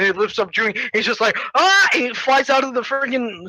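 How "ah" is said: short, high, loud cry; mock yelp. Hero lifted by goop, mock yelp. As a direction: yelp as you're launched. Acting Junie being lifted.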